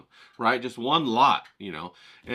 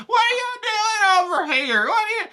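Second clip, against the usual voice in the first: shrill Karen impression; 4 dB louder than usual. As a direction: shriek it like a nosy neighbor scolding. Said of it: "got her attitude rolling".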